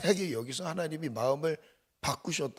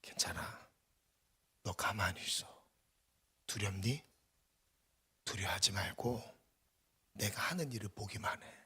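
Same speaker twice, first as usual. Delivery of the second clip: soft, low, tender whisper; 부드럽게 속삭이는 위로; God comforting; 5 semitones lower, 7 dB quieter than usual. Acted. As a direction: hush a frightened child gently. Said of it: "하나님이 안아주세요".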